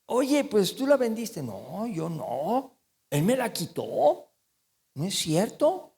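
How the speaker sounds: lightly voiced liars bickering, mock denial. Preacher mimics liars blaming each other. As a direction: play the sly liars passing the blame. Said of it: "fíjense cómo son los mentirosos".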